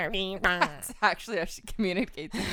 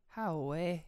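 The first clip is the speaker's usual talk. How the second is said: Geordie phrase; 3 semitones lower, 4 dB quieter than usual. Retold or imitated. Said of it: "What's that thing y'all say?".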